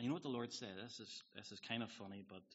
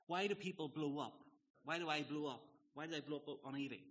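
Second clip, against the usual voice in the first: ordinary delivery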